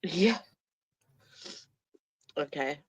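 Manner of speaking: ordinary talk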